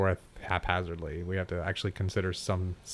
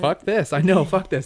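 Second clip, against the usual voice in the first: mock outburst; 10 semitones higher, 9 dB louder than usual. Mock outburst echoing guest.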